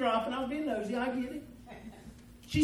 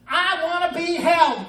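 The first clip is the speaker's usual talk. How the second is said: loud, high, drawn-out demand; mock baby's cry; 7 semitones higher, 9 dB louder, slower than usual. Baby wanting to be held, mock demanding cry. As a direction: imitate a wailing baby in words. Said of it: "there's a different cry".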